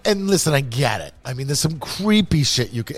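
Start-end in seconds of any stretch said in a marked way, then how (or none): none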